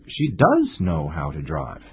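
emphatic "does", falling away after it; stress on does. Shown as a demonstration. Contradicting the claim she doesn't know how to drive, stress on "does". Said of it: "Emphatic sentences are usually used after".

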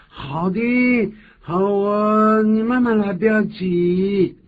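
high, slow, gentle fatherly reply; reassuring dad. Acted. Father turning back to reassure his cute son, high and gentle. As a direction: soothe the little boy, drawn out. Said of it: "我就说".

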